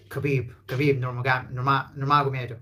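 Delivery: حبيب turned into كبيب in a mock English accent. Teacher mocking English speakers saying the name. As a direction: imitate an English speaker mangling نور محمدوف. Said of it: "الإنجليزي شيء بعيد جدًا".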